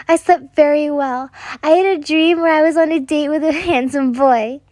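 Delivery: put-on high, giggly child voice; a small girl gushing with a laugh; little girl voice.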